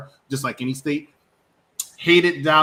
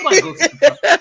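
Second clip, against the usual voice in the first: shouted high through laughter; laughing shout; 10 dB louder than usual. Real, not acted. To his co-host, mocking Kentucky, laughing shout. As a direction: laugh it out loud at the idea.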